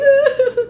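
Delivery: high, loud fake bawl; exaggerated wail of crying; mock crying wail. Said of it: "crying all over her boobs".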